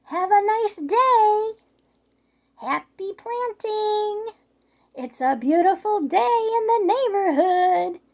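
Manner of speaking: high, loud, slow fake-cheerful call; overly sweet taunt to the neighbour; sarcastic sing-song.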